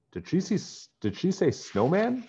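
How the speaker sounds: high, startled double take. A narrator's reading. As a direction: catch the word in surprise. Nancy startled by the news.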